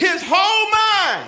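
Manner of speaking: high, drawn-out preacher's holler; loud, ringing proclamation; call-out. Real, not acted. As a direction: call it out for the crowd to repeat. Verse fed to congregation line by line.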